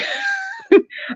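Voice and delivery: squealing laugh near tears; real high laugh; high crying laugh. Real, not acted. Laughing about crying over taxes.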